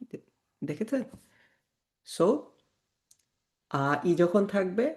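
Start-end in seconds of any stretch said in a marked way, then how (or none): none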